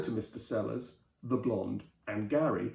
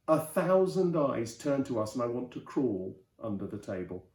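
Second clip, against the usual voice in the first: usual speaking voice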